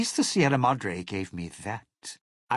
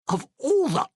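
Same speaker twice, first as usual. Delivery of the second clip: high, louder burst of exasperation; exasperated outburst; 4 dB louder than usual. Acted. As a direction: break out in sudden annoyance. Cuts off in exasperation at holograms.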